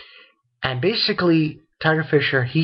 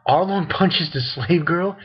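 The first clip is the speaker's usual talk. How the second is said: ordinary talk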